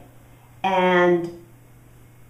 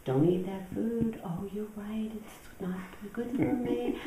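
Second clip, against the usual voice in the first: soft, hushed self-scolding; disciplinarian good voice; 2 semitones higher, 10 dB quieter than usual. Acted. Good voice warning herself off food, hushed and dutiful. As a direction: murmur a rule to yourself and agree. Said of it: "the disciplinarian is my good voice".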